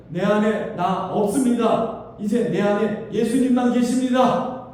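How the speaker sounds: loud, slow, solemn proclamation; Paul's declaration. Retold or imitated. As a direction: declare it like Paul's final confession. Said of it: "마지막엔 이런 말을 하죠". (바울의 고백)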